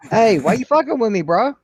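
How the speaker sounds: high, loud mock indignation; playful fake outrage; mock confrontation. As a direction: jokingly challenge your buddy. Joking confrontation with laughing friend.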